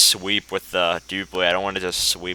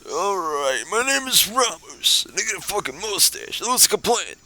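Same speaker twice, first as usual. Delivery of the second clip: put-on high old man voice, slipping a little; old codger voice, half dropped; 12 semitones higher than usual. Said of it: "time to do the old man voice".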